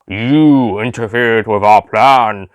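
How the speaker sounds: very slow, flat robotic drone; robotic Cyberman voice. A narrator's reading. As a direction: speak like a cold machine, word by word. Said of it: "slightly human-like robotic response".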